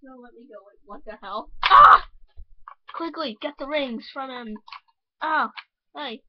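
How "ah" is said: short scream, very high and loud; a sudden shocked shriek; startled scream. Acted. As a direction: cry out in fright.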